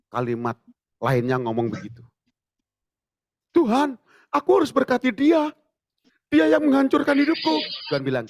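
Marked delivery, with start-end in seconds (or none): 3.5-7.5 high, loud, resentful complaint to God; bitter protest. Retold or imitated. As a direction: protest like a wronged man.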